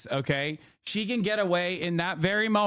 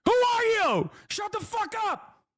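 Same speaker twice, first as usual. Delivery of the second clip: very high-pitched mock indignation; exasperated high-pitched retort, played for laughs; mock outburst; 13 semitones higher, slower than usual. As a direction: re-enact losing it, hammed up and squeaky high. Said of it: "I was like".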